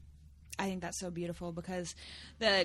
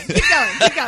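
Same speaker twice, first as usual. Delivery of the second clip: much louder, laughing encouragement; egging on loudly; 3 semitones higher, 21 dB louder than usual. Real, not acted. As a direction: shout it like cheering him on.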